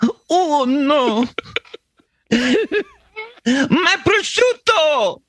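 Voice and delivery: slow, high mock Italian lament; Italian accent. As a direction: mourn your prosciutto like a comic Italian. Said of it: "I'm doing an accurate Italian accent".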